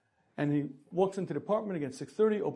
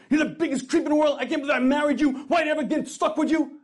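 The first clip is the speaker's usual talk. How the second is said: high, loud, fast tirade; wife exploding at her husband, fast and furious; furious outburst; 9 semitones higher, 8 dB louder, faster than usual. Acted. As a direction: unload on him all at once. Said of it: "She starts calling him a heartless creep".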